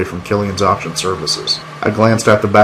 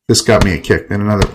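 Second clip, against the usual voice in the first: ordinary delivery, nothing marked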